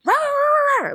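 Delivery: high mock bark; imitated dog barking. Telling friend about the muzzled dog, high imitated barking. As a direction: yap like a crazy muzzled dog. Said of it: "barking like that".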